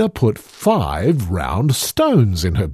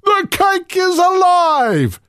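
much higher, alarmed cry; frightened giant; 3 dB louder than usual. A narrator's reading. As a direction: cry out in panic. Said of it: "cried the giant".

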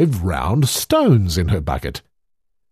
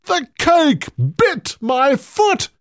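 much higher, alarmed cry; panicked giant. A narrator's reading. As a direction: wail it in panic. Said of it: "cried the giant".

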